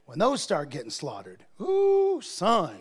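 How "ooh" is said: sudden high whooping ooh. Real, not acted. Preacher whooping ooh to the congregation. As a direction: yelp it high and loud.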